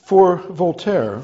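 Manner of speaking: ordinary talk, nothing marked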